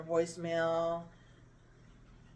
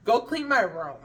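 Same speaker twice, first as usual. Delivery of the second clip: louder, higher mock command; imitated bossy order; 5 semitones higher, 6 dB louder than usual. Cousin sending her to clean as punishment, mock bossy order. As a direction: order them about, mimicking a bossy relative.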